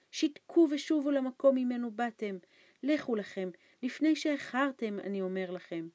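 loud, high, panicked pleading; frightened urging. A narrator's reading. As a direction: drive them away out of fear. Terrified old man urges leaving.

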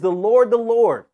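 high, loud, ringing call; ringing proclamation. Acted. Preacher reading God's self-proclamation, loud and high. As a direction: announce the name with full force. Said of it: "He says it twice as to emphasize".

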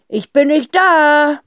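the father cries out from his hiding place; panicked father. A narrator's reading. Father hiding under the table from the call, panicky.

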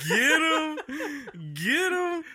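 real laughing yell at the screen; excited cheer. Real, not acted. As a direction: cheer the guy on like at a fight. Cheering at the video's payoff.

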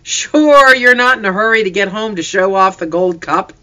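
high, loud teasing laugh in the voice; amused father ribbing his son; laughing tease. A narrator's reading. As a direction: chuckle the tease out. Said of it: "Papa laughed".

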